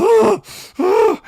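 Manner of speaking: high, loud huffing groans; mock frustrated groan. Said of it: "I was pissed".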